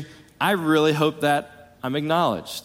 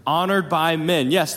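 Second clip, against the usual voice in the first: normal talking voice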